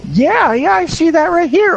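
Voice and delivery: hammed-up, very high old-lady falsetto; old woman. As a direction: squeak eagerly like an elderly caller. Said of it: "to an eighty-five-year-old woman".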